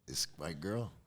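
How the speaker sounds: low, soft homeboy brag; lightly put-on low, cool voice; talking to a buddy.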